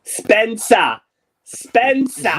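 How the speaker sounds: loud call across the room. Real, not acted. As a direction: shout the name to get attention. Calling out to Spencer.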